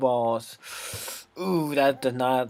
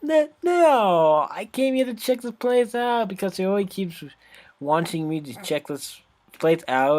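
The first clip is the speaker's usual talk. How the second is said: high flustered protest; 6 semitones higher, slower than usual. Acted. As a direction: blurt out a panicked denial.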